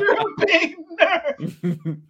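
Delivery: high, slow, stretched-out jeer; hammed-up schoolyard teasing; mocking taunt. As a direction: drag out the insult in a put-on cry. Acted mocking taunt, repeated.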